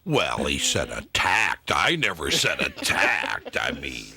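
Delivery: low, slow mock guest voice; imitating the guest. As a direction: drag it out like the dull guest. Mocking the guest's interview answers.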